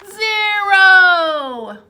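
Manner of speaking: a loud, drawn-out "zero"; big reveal. Acted. Teacher reveals nothing is left, loud and drawn out. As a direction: stretch the answer out for effect. Answering the count.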